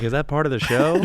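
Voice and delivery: mock audience question, high and loud; baffled audience member. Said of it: "People are like".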